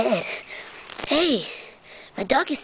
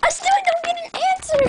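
very high exasperated shriek; hammed-up high-pitched freak-out; frantic squeal; faster than usual. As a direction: squeal it in mock desperation. Dog will not answer.

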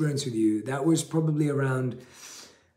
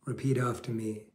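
guided meditation; 2 semitones lower, 6 dB quieter than usual. Acted. Leads a guided breathing meditation.

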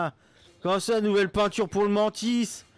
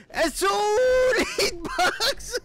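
high slow whine; 8 semitones higher, slower than usual. Real, not acted. Searching for hitboxes.

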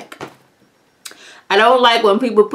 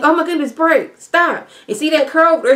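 panicky; 6 semitones higher than usual. Retold or imitated. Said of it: "He's like".

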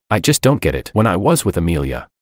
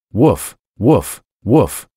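dog barking; 3 semitones higher, much slower than usual. A narrator's reading. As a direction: bark like a guard dog. Narrator voices dog barking.

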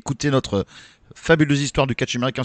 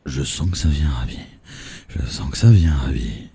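much lower, ominous teasing voice; deep mysterious voice; 13 semitones lower than usual. Acted. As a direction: drop into a deep spooky tone. Quizmaster teases the answer coming. (voix grave et mystérieuse)

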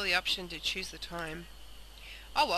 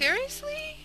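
surprised. Real, not acted. Reacting to cookies.

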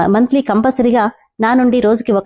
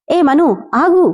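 calling out; 6 semitones higher, slower than usual. Acted. Calling after leaving girl.

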